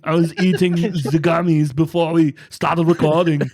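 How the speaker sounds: loud, dopey stoner voice. Retold or imitated. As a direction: play a very high friend. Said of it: "my impression of Stubbs talking about how high he is".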